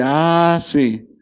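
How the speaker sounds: low, falling mock realization; memoria que recuerda. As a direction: play the memory giving in, dropping low. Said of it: "nuestra memoria dice". (recuerdo fingido, en tono grave)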